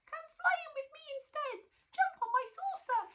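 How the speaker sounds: very high, eager squeak; tiny monster. Acted. Tiny monster inviting Natalie, high eager squeak.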